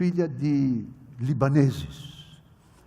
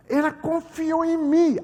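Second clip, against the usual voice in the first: emphatic high; 12 semitones higher, 5 dB louder than usual. Real, not acted.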